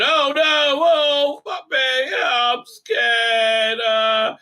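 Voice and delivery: high, loud frightened yelps; hammed-up panic while reliving the duel; mock terror. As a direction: flail and yelp like the fight is back. Reliving the lightsaber fight in fright.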